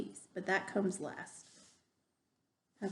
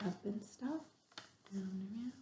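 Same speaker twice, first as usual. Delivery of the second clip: quiet instructions; 10 dB quieter than usual. Shown as a demonstration. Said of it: "It has some instructions".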